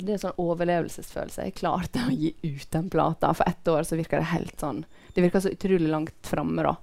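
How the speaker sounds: usual speaking voice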